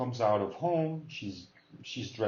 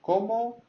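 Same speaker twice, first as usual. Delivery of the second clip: ¿Cómo? high and a bit louder; high-pitched CÓMO; 11 semitones higher, 5 dB louder than usual. Shown as a demonstration. Teacher models the question word, CÓMO high-pitched. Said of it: "I teach".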